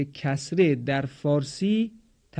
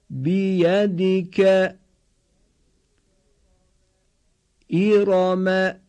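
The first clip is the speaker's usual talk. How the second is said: slow, higher, level chant-like syllables; repeat-after-me model; 4 semitones higher, 5 dB louder, much slower than usual. Shown as a demonstration. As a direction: hold every syllable evenly on one raised note. (شمرده و کشیده با آهنگ یکنواخت، برای تکرار)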